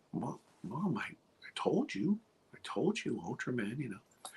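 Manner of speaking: put-on soft, fading kid's plea; a little boy quietly insisting to his mom; hushed child. Child explains to mother.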